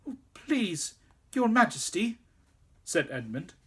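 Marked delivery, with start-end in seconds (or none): 0.1-2.2 much higher frightened plea; pleading. A narrator's reading. Boy begging the angry queen, frightened plea. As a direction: beg her anxiously.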